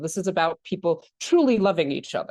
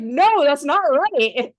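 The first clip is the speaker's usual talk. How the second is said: drawn-out, high, loud mock protest; protesting grandson; 7 semitones higher, 5 dB louder, much slower than usual. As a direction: object like an outraged kid, stretching it out. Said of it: "Just like".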